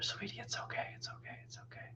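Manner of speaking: put-on hushed whisper to a baby; cradling and hushing a crying baby; whispered soothing. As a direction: whisper comfort into her ear.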